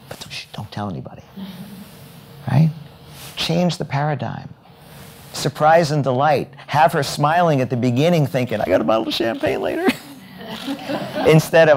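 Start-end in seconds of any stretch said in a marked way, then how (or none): 0.1-1.2 hushed secret. Retold or imitated. Conspiratorial hush. 8.6-9.9 high, fast, gleeful inner voice; giddy exhibitor thinking about her gift; delighted thought. Retold or imitated. 10.4-11.2 light laugh. Real, not acted. Laugh after gleeful line.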